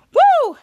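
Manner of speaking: high, loud acted whoop; hammed-up cheer of relief; relieved whoop. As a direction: let out a big "woo". Hero whoops after hanging up.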